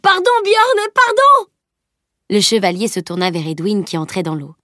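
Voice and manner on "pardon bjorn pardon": crié très aigu et fort, paniqué; cri suppliant. A narrator's reading. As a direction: supplie en hurlant, pris de panique. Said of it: "Il cria". (Salim soulevé supplie Bjorn en criant, affolé)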